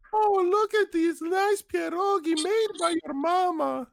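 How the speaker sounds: very high put-on voice; mock Polish male. Mimicking a Polish mating call, very high voice. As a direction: play a silly Polish man calling out. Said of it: "it's like a Polish male".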